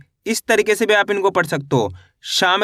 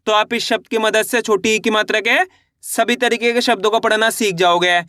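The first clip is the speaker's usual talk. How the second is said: ordinary delivery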